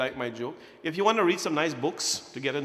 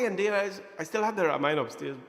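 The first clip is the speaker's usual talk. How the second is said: normal talking voice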